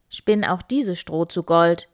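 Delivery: king's command. Acted. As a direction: order it done. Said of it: "sagte der König".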